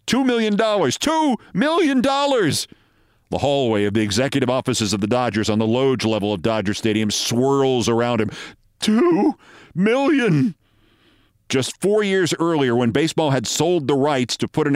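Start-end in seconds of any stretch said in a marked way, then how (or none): 0.1-2.7 high, disbelieving repetition, slowing at the end; panicked thought. A narrator's reading. Manager repeating the bad news to himself, high panic. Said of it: "he thinks to himself". 8.8-10.6 high, very drawn-out disbelief; stunned panic. A narrator's reading. Manager reeling in the hallway, slow high-pitched panic.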